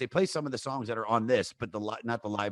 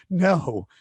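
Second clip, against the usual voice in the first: higher, louder panicked list of losses; retold panic; 7 semitones higher, 6 dB louder than usual. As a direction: rattle off the stolen things in alarm. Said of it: "And she goes".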